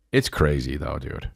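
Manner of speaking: ordinary delivery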